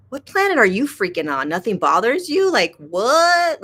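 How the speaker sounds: incredulous retort, rising high and loud on the last word; playfully outraged disbelief; mock incredulity. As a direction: challenge them in exaggerated disbelief. Acted disbelieving retort to serene person.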